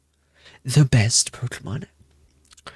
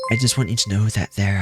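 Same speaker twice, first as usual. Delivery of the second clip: ordinary talk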